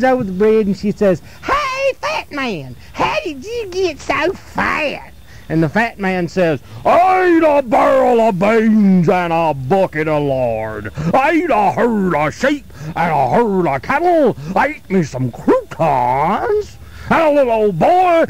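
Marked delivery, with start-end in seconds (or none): 1.4-5.1 put-on much higher, softer, slow little-girl voice; slow squeaky high mocking call; girl's teasing taunt. Little girl taunts the fat man. 6.8-16.8 slow, booming monster voice; mean giant's boast. Acted. Giant fat man answers again.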